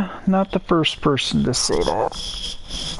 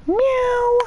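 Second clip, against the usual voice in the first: high meow; 3 dB louder than usual. Pretend. Meowing at the kitten.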